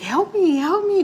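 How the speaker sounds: high mock cries for help; retold pleading scream. Sick neighbor begging husband for help, high imitated cries. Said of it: "she's all screaming".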